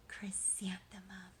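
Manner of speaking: soft slow whisper of the name; hushed reveal. A narrator's reading. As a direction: breathe the name as a secret. Baby named in story climax.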